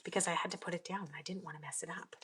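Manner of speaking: fast breathy whisper. Real, not acted. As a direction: rush it out in a whisper. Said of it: "I'm totally holding my breath".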